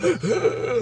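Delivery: a sudden, high, panicked gasp; a high frightened gasp for air; sharp gasp. Retold or imitated. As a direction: gasp in terror. Thinking he will die.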